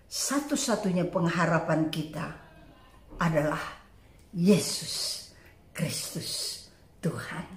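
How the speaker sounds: low, soft, falling away to near whisper; hushed, low declaration of faith; solemn hush. Real, not acted. Preacher drops to solemn hush.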